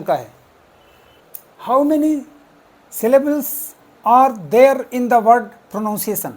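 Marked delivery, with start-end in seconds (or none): 1.7-6.3 slow question read. Shown as a demonstration. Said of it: "pronunciation".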